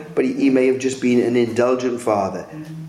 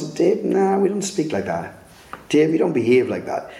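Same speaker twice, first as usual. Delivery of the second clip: God correcting Dave; 6 semitones higher than usual. Retold or imitated. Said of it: "And God says".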